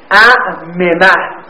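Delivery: اعممه drawn out slowly before the merge, syllable by syllable; slow demo form. Shown as a demonstration. Teacher slowly showing اعممه before merging, drawn out. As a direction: lay out اعممه slowly so the two mims stand apart. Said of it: "حرکهٔ میم رو بدید به ما قبل".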